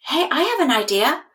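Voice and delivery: bright offer from the kind soul, rushing; eager friend. A narrator's reading.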